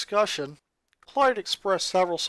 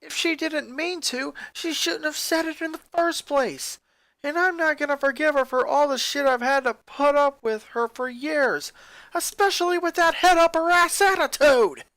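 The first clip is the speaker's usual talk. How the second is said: rising to loud, higher furious rant; angry rant; 8 semitones higher, 4 dB louder than usual. Acted. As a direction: throw her words back at her, getting louder. Lincoln's angry rant in library.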